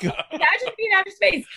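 "imagine me in outer space" said through a high laugh. Real, not acted. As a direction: giggle the words out.

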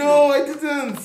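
high, drawn-out protest; dismayed realisation. Real, not acted. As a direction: stretch it out high, aghast. Caught out, exclaims in dismay.

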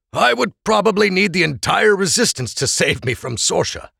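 lightly voiced Oral, wry and half joking; wry father. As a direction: own up to being protective. Oral confessing precautions.

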